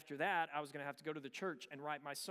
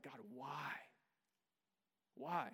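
softer, aching question to God; quiet pleading why; 5 semitones lower, 6 dB quieter than usual. Retold or imitated. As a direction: ask it softly, exhausted. Retold prayer asking God why.

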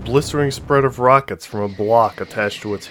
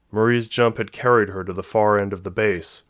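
usual speaking voice

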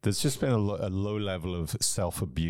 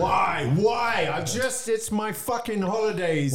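high, loud, frustrated yelling at the sky; shouting "why" in disbelief; exasperated rant; 11 semitones higher, 4 dB louder than usual. Retold or imitated. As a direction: rage at seeing the ex on holiday. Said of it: "And I'm just like".

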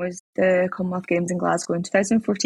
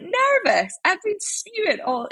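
high-pitched, indignant disbelief at being called nervous; mock outrage, voice shooting way up; incredulous retort; 12 semitones higher, slower than usual. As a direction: squeak it out like you can't believe what the coach just said. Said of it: "And I was like".

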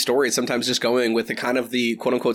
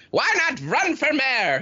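put-on high, slow old-timer voice; old man voice; 12 semitones higher, slower than usual. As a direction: ask like a folksy grandpa. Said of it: "you kinda like".

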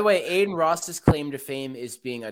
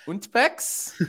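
mock German; 10 semitones higher than usual.

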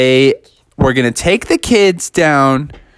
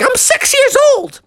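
piercing high falsetto protest; indignant little kid; 3 dB louder than usual. Acted. Junior insisting on his age to the employee, squealed protest. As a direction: squeak it in outrage. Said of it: "He's like".

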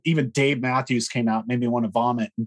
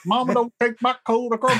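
mocking Southern country twang, pitched much higher; mock Southern accent; 10 semitones higher than usual. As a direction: send up a singer slipping into a put-on Southern accent. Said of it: "suddenly they get a Southern accent".

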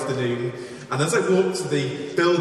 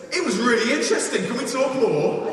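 friendly lad; 8 semitones higher than usual. Retold or imitated. The big lad asking the speaker to talk more, eager and higher. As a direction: play the lad's unexpected warmth.

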